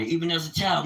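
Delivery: normal talking voice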